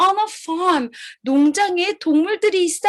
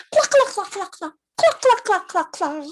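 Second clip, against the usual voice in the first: hens clucking; 3 semitones higher than usual. Pretend. Hens talking to each other.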